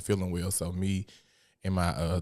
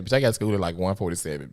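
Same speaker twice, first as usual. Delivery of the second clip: ordinary talk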